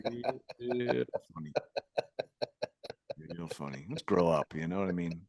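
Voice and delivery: low, quiet deadpan through stifled laughter; dry mock scolding. Real, not acted. Host chides Ben over a joke name, dry mock scolding. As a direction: drop it low and soft, unamused.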